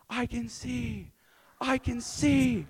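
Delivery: high, drawn-out jubilant cry; imitating ecstatic cries. Retelling a healed lady running around, imitated joyful cry. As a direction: call it out way up high, stretching the words.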